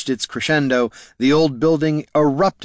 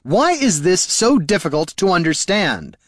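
raised, exasperated complaint; snapped; 7 semitones higher, 3 dB louder than usual. A narrator's reading. Bard fed up with his companions, snapped. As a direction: demand it in exasperation. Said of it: "snapped Haraldin".

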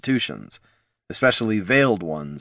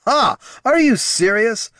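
scoffing disbelief; 5 dB louder than usual. Acted. King scoffs at demand.

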